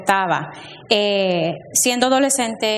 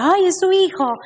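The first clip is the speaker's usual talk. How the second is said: nosy bystander; 10 semitones higher than usual. Retold or imitated. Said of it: "la gente me decía".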